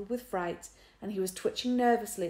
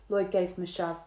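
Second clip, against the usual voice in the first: usual speaking voice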